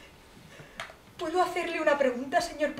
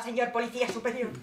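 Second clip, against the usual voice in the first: normal talking voice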